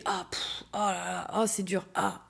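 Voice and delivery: soft strained panting sounds; imitating her own workout grunts; mock straining groans. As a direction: groan softly as if mid-workout. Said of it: "je vais faire des trucs comme ça".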